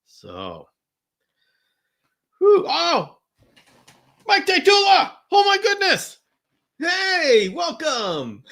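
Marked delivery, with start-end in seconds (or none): 2.4-8.4 very high, louder, overjoyed; thrilled greeting. Real, not acted. Host spotting a favourite viewer joining the stream, overjoyed squeal. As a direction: squeal with delight at a surprise guest.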